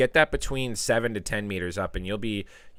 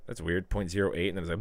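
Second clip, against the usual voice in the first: ordinary talk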